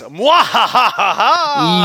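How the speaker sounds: slow evil villain laugh. Acted. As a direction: cackle like a cartoon villain. Self-professed villain.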